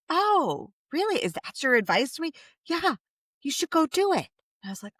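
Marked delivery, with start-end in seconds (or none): 0.1-2.3 high, sing-song fake astonishment; mock surprise. Mother teasing son about his advice, mock surprised. 2.7-4.3 high, lightly voiced little boy; a kid answering matter-of-factly; son's voice. Mother voicing her son giving advice, lightly high.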